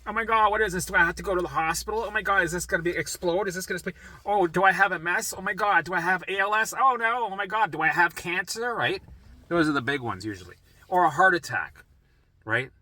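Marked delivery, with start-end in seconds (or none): 0.1-8.7 higher-pitched mock panic, questions tumbling out; exaggerated health-anxiety panic; acted freak-out. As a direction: spiral through worst-case worries in a raised voice. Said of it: "when I would freak out".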